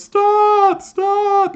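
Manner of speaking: very high, loud shout of stop; shouting security guard. Retold or imitated. Guard in Austin Powers shouting at the steamroller, high shrill yell. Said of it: "and he goes".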